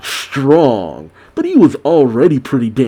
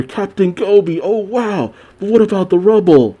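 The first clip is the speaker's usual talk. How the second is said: higher-pitched voices of an amazed crowd; mock a cheering crew, lightly; imitated cheering; 8 semitones higher than usual. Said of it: "The Marines cheer".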